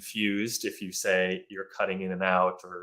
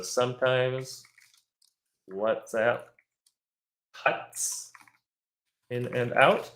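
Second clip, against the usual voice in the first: model sentence; 4 semitones higher, slower than usual. Shown as a demonstration. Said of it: "so you can say a couple things".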